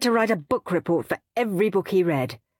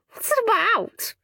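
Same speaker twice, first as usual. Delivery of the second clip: much higher, mocking question; snorting. A narrator's reading. Henry mocking Peter's silly book, snorting. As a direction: ask with a derisive snort. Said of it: "asked Henry, snorting".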